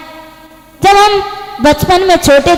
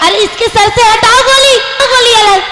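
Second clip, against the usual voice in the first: high, urgent shouted plea; alarmed cry; 6 semitones higher than usual. Acted. Woman pulling the gun off the holy man's head, alarmed cry. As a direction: cry out to get the gun away.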